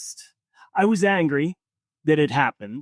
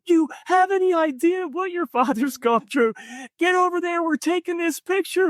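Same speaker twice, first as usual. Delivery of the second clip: retold yelling. Stranger scolding the son to join the photo, mock yell. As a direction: scold him in a shrill-pitched retelling. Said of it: "she's yelling at my son".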